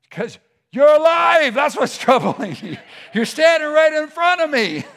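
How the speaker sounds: high, loud acted outburst; incredulous shout. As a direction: shout back in comic disbelief. Acts disciples' troubled reply to Jesus.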